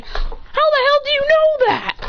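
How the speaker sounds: high, drawn-out incredulous outburst; shocked demand. Acted. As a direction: demand it in acted disbelief. Character demands an explanation in shock.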